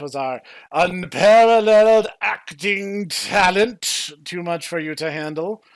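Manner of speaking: grand, slow, loud showman voice; mock dramatic. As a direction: ham up the boast theatrically. Mock dramatic sign-off taunt.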